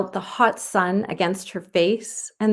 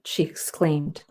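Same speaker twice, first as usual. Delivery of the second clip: usual speaking voice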